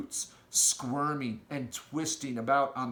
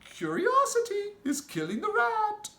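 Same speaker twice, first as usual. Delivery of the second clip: a big gentle giant with odd grammar; friendly giant; 4 dB louder than usual. Acted. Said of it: "the B F G said".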